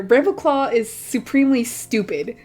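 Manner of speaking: ordinary talk, nothing marked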